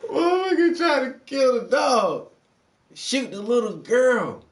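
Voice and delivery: loud high angry yell, slowing at the end; outraged shout. Real, not acted. Outraged at the cop shooting the girl, loud angry yell. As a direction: shout it in disbelief.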